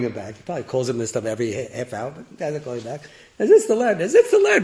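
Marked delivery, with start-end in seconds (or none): 3.4-4.6 high, laughing delight. Real, not acted. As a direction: laugh through the repeated words.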